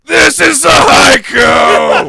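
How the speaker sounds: slow, loud battle cry; defiant shout. Acted. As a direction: roar your last words. Character's final defiant cry.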